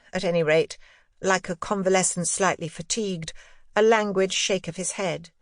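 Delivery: ordinary delivery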